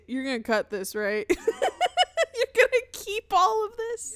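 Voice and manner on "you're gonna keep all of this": high, slow, laughing question; real laughing. Real, not acted. Asking whether the freak-out gets kept, laughing. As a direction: ask it through helpless giggles.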